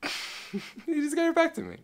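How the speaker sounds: giggling through the words, very high; can't stop laughing as you say it; real laughter retelling the apple moment. Real, not acted. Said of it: "I thought that was so funny".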